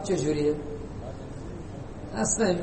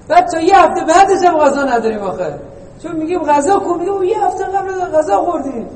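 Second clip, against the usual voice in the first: joking; 6 semitones higher, 6 dB louder than usual. Pretend.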